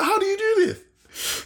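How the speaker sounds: high, slow, baffled question; astonished, pitch way up; incredulous. Retold or imitated. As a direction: ask in stretched-out disbelief. Acted disbelief at friends.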